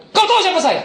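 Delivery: high, loud intimidating question; barked challenge. Retold or imitated. Pastor intimidating a thug, acted shouted challenge. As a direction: pull rank on him, re-enacted. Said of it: "Langsung saya bentak".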